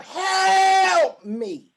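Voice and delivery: high, loud desperate scream to the heavens; screamed plea. Retold or imitated. Recalling her prison plea to God, screaming at the top. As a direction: cry out to God with everything you have. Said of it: "I screamed to the loudest that I could".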